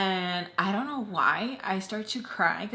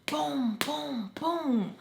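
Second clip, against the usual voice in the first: high, booming knock imitation; imitated banging; 7 semitones higher, much slower than usual. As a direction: mimic three bangs with a falling, drawn-out "boom". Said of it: "we literally hear someone go".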